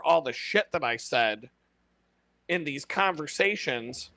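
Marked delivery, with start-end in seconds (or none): none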